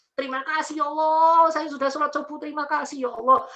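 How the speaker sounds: high, heartfelt call to God; acted thankful prayer. Shown as a demonstration. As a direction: demonstrate grateful praying aloud. Models a thankful prayer aloud.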